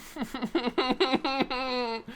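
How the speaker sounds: high, soft stuttering moan; long quivering hum of misery; distressed whimpering. Real, not acted. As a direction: whimper through closed lips in despair. Agonizes over the loss.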